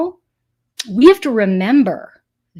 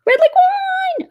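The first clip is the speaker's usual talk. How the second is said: a gasp, then a much higher, slow delighted cry; gasping surprise; 4 dB louder, slower than usual. Real, not acted. Reads out a viewer comment.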